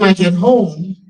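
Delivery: ordinary delivery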